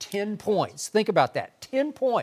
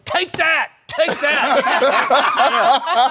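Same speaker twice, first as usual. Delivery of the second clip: high, loud heckler's yell; mock crowd yelling; 9 semitones higher, 6 dB louder, much slower than usual. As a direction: yell it like a jeering fan. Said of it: "everybody's yelling at me".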